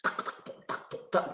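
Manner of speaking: crisp whispered keyboard taps; clearer typing sound. Retold or imitated. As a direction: imitate typing heard close by, unvoiced. (tiruan suara ketikan yang jelas)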